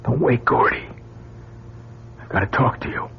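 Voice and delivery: breathy secret whisper; hushed whisper. Acted. Secret night talk.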